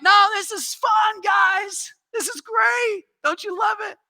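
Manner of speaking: high, slow delighted shout; gleeful carefree dad. Retold or imitated. Preacher imitating a dad thrilled by an earthquake, high gleeful shouts. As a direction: cheer the family on like it's a ride. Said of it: "he's just like".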